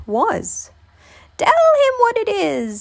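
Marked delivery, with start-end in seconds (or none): 1.4-2.8 put-on very high old woman voice; old woman's suggestion. A narrator's reading. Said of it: "suggested the old woman".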